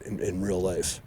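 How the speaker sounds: ordinary talk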